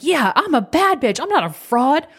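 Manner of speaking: very high, lit-up declaration; full of excitement. Retold or imitated. Imitating a client who lights up, high and excited. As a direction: proclaim it high, thrilled with yourself. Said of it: "she's full of this excitement in herself".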